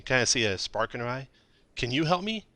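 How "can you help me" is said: much higher, begging woman; pleading. Acted. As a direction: ask for help desperately. GM voices the desperate mother.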